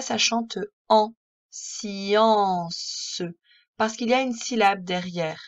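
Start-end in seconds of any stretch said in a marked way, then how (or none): 0.0-3.2 « science » très lent, le si bien chanté; son si appuyé. Shown as a demonstration. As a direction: fais chanter le si. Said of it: "Tu as vu que là". (« ça chante en science » dit très lentement)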